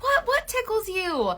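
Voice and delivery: high, slow playful tease; teasing mock innocence. Mom teasing her toddler, high mock-innocent voice. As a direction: play dumb with your kid.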